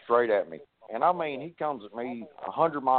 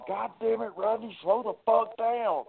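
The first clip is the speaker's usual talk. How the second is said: high-pitched mock holler, slowed down; 12 semitones higher than usual. Doug hollering at Rodney across the ring, high mock holler. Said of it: "Doug's hollering across the ring".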